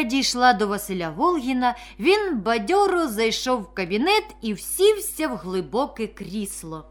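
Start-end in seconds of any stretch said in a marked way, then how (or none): none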